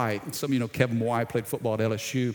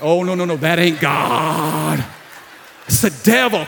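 mock rebuke; 5 dB louder than usual. Pastor rebuking his own thought, loud mock rebuke. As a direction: scold the thought off like the devil. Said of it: "had to rebuke that thought".

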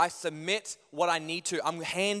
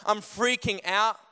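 ordinary delivery

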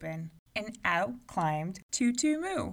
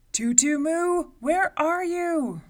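loud, slow, higher booming call; angry giant calling; 10 semitones higher, 10 dB louder, slower than usual. A narrator's reading. Angry giant calling for the hiding girl, booming. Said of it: "called again".

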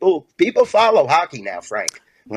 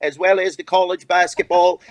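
higher hammed-up British accent, held through the sign-off; 5 semitones higher than usual. Guest signs off to the hosts in a mock British accent. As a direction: play a cheery Brit thanking the hosts. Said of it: "I just wanna hear you talk in a British accent".

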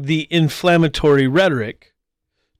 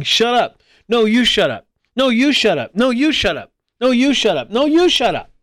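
higher-pitched mock kids' squabble, climbing; squabbling playground children; 4 semitones higher than usual. Children on a playground shouting back and forth, mock squabbling. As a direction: bicker like kids trading the same retort. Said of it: "shouting back and forth saying".

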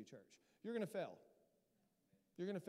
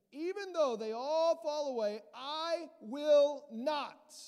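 loud, high and drawn out on will not; bold vow; 8 semitones higher, 11 dB louder, slower than usual. Acted. Peter swearing loyalty to Jesus, loud proud vow. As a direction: proclaim it like a proud promise.

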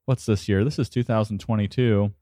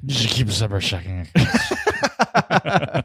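booming tipsy uncle; drunk uncle voice; 4 semitones higher than usual. Pretend. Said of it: "saying this in drunk uncle voice".

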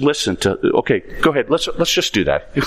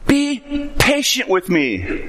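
pleading servant; 7 semitones higher, slower than usual. Acted. Servant begging master, slow plea.

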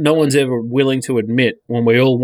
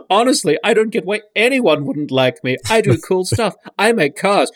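higher-pitched mock pleading of an insecure billionaire; needy put-on voice; 6 semitones higher than usual. Spoof billionaire begging to be found cool, high mock-needy voice. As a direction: plead for approval, pitched up and hammed-up. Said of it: "I'm reading it in this voice".

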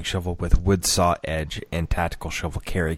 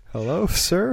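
confused question; 10 semitones higher than usual. Retold or imitated. Confused Japanese man answering.

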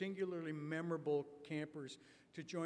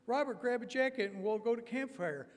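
put-on eager kid invite; 7 semitones higher, 8 dB louder than usual. Boys inviting Robert.

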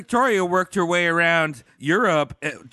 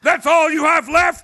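high, loud indignant question; loud mock outrage; 11 semitones higher, 8 dB louder than usual. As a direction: blast the question back. Mock soldier reply.

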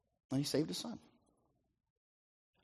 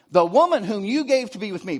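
blaming man; 6 semitones higher, 7 dB louder than usual. Acted.

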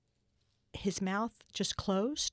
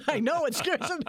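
laughing through the words, much higher and louder; 9 semitones higher, 5 dB louder than usual. Real, not acted. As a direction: laugh it off while talking. Laughing about looking intimidating.

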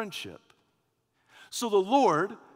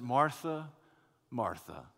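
soft name, much lower on the repeat; affectionate call; 5 semitones lower, 6 dB quieter than usual. Acted. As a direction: gently chide with endearment. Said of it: "It's a term of affection".

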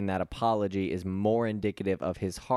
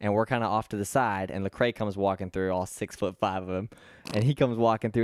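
normal talking voice

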